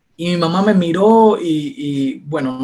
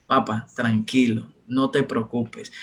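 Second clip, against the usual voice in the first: quiet reassuring son; calm reassurance; 6 semitones lower, 6 dB quieter than usual. Retold or imitated. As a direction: gently soothe a worried father. Son calms worried father.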